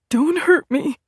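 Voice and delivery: high, frightened squeal; terrified plea in a high squeak. A narrator's reading. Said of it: "he squealed".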